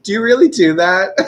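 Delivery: high, loud question through laughter; laughing disbelief. Real, not acted. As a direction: ask it incredulously while cracking up. Asks while cracking up.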